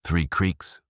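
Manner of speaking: ordinary delivery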